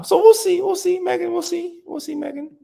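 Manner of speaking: sing-song high teasing; silly high voice. Pretend. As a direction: tease Megan in a squeaky voice.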